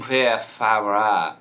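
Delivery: loud, hammed-up Spanish-style pronunciation; big, drawn-out foreign-accented flourish; mock foreign pronunciation. Shown as a demonstration. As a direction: show off an exaggerated Spanish accent. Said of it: "could you pronounce it for me?".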